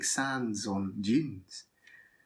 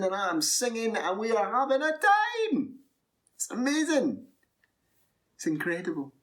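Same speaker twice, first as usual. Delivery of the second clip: high-pitched, mounting excitement; exhilarated; 8 semitones higher than usual. Acted. Storyteller reliving harp, dancing and singing, exhilarated. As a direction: let the joy climb to a peak. Narrator relives a wild party.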